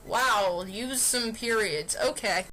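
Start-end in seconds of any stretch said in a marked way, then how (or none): none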